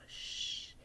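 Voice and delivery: soft, voiceless "shh"; retold shushing. Guy hiding with the dreamer, shushing her. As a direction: hush someone under your breath.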